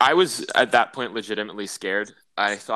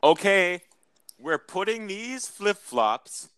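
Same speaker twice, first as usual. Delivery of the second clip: higher, slow, announced to the room; loud slow announcement; 8 semitones higher, much slower than usual. Retold or imitated. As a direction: call it out slowly for all to hear. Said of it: "loudly announced".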